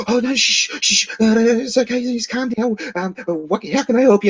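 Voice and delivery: high, hammed-up placating official; mock soothing clerk. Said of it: "come run".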